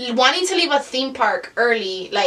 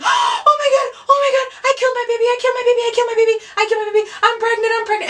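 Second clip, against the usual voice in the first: very high, rapid re-enacted screaming; acted hysterical shriek, racing along; panicked screaming; 12 semitones higher, 4 dB louder, faster than usual. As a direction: wail it over and over in panic. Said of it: "I started screaming".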